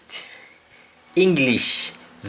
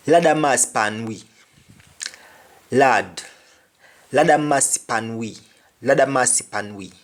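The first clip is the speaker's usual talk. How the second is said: patois Lord have mercy pan we; Jamaican patois. Shown as a demonstration. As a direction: plead in patois. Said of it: "Lord have mercy on us".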